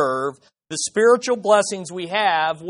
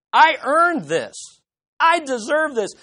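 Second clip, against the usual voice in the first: smug entitled voice; 7 semitones higher, 4 dB louder than usual. Pretend. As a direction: savor how much you deserve it. Voicing an entitled believer.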